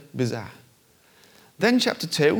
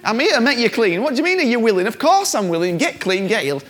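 high, loud exasperated retort; indignant Jesus; 10 semitones higher, 6 dB louder than usual. Retold or imitated. Jesus exasperated at the leper's doubt, loud and high. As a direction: scold him, of course I'm willing.

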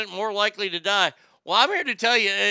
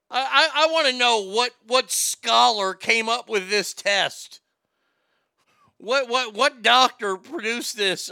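louder and higher, sputtering in disbelief; exasperated outburst; 3 semitones higher, 4 dB louder than usual. Real, not acted. Ranting to listeners about the flamingo test, exasperated and loud. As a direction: demand to know who dreamed this up. Outraged rant at the researchers.

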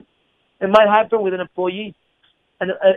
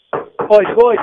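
usual speaking voice

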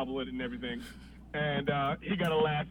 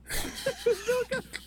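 high, slow laughing squeal; giggling high; laughing disbelief; slower than usual. Real, not acted. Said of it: "laughing high".

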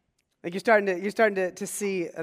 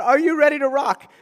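louder and higher, a mock arena shout; rock star hyping a crowd; 6 semitones higher, 9 dB louder than usual. Pastor imitating a rock star calling out to his crowd, loud and high. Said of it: "a rock star says to a crowd".

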